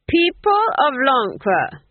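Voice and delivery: loud, high, slow proclamation; ringing public address; crying out. A narrator's reading. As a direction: duke addressing the crowd. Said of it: "he cried".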